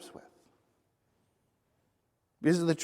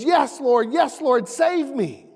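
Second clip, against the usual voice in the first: high, louder pleading; desperate plea; 7 semitones higher, 3 dB louder than usual. Retold or imitated. Man dangling from the cliff pleading with the Lord, high and urgent. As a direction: beg the Lord to save him. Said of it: "And the man says".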